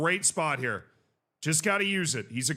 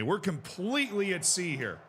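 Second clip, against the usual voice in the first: ordinary talk, nothing marked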